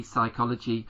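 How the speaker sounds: ordinary delivery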